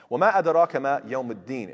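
fast, chanted Quranic recitation. Acted. Teacher reciting the verse to students, chanted and fast. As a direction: recite the verse in full tajweed flow.

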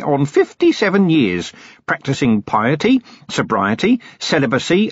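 a cheerful, long-winded hermit eager to explain; chatty old hermit. Acted. Hermit explains his profession.

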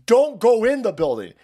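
mock megaphone yell; retold scream. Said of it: "he's screaming".

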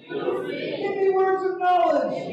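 pleading slowly for words of knowledge; slow prayer. Shown as a demonstration. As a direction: draw each word out as a prayer. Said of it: "prayer line led for congregation".

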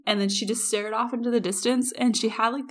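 ordinary delivery

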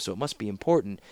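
usual speaking voice

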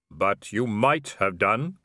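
slow, solemn counterpoint; Death. Acted. Robed figure counters Vimes's protest, slow solemn voice. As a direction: draw it out evenly, with sombre weight.

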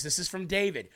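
usual speaking voice

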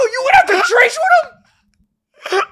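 very high, drawn-out squeal through laughter; incredulous shout. Retold or imitated. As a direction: shriek it at your friend, cracking up. Retold disbelief, cracking up while shouting.

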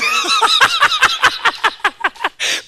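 squealing falling laugh; helpless high laughter; high shrieking laugh. Real, not acted. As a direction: let a shrill laugh fall away. Uncontrollable laughter.

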